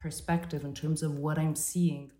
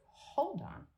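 a quick jolt of surprise, then softer questioning; sudden doubt; 3 semitones higher, 5 dB quieter than usual. Retold or imitated. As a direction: catch yourself mid-stride, confused. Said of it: "it's like".

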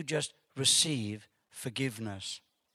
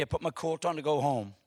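ordinary talk, nothing marked

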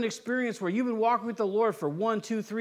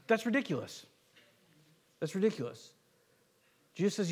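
ordinary talk, nothing marked

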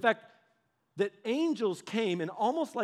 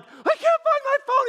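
put-on high, loud childish whine; whiny grown-up; 10 dB louder than usual. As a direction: whine it like a kid who lost a toy. Us whining to God.

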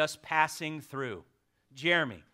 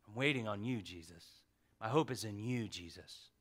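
quiet prayer; 4 semitones lower, 5 dB quieter than usual. Retold or imitated. Voices a believer's quiet prayer.

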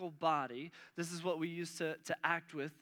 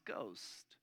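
soft, low landing; 7 semitones lower, 8 dB quieter than usual. Acted. Landing the translated word.

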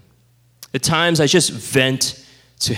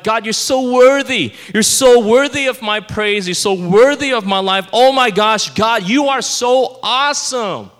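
gushing, raised, pumped-up praise prayer; mock Christianese greeting; 6 semitones higher than usual. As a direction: ham up a churchy flattering prayer. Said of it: "start off with a Christianese greeting".

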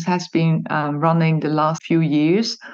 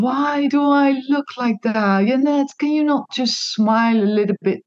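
higher-pitched exasperated quote of herself; mock self-scolding; 6 semitones higher than usual. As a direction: complain at yourself, half-laughing, pitched up. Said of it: "these kind of things I was telling myself".

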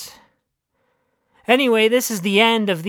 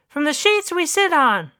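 high dog voice; 8 semitones higher than usual. Acted. Dog continues testimony.

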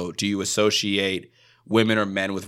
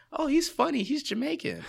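mocking listener; 4 dB quieter than usual. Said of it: "They're listening for".

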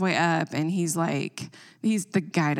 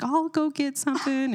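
worker's voice; 9 semitones higher than usual. Retold or imitated. Guy quoted in story.

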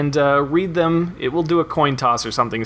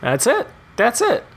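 excited; 11 semitones higher than usual. Real, not acted. Host cheering approval.

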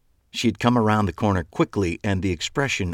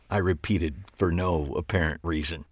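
ordinary delivery

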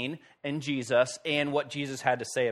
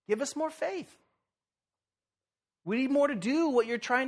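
whiny plea; 8 semitones higher than usual. Retold or imitated. Disciples begging Jesus.

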